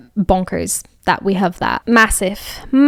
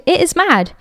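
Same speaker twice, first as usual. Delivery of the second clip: exclaiming; 9 semitones higher than usual. Real, not acted. Exclaiming about juggling uni and job.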